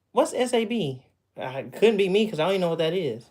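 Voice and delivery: normal talking voice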